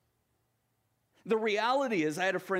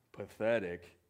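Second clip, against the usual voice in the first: low, gloomy donkey mutter; gloomy Eeyore; 8 semitones lower, 4 dB quieter than usual. Retold or imitated. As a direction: sigh it out like a defeated old donkey. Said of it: "he said".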